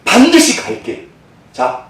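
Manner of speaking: ordinary talk, nothing marked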